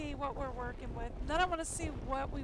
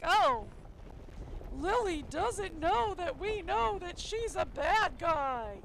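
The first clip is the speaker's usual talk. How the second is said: higher, louder, slow sing-song mockery; mocking enemy voice; 5 semitones higher, 7 dB louder, slower than usual. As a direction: parody their fake innocence. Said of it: "still trying to play like".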